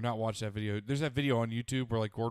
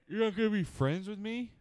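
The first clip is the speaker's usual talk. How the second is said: high mock-wounded whine; mock hurt; 10 semitones higher than usual. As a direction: fake hurt, high and pleading. Mock hurt at friend's joke.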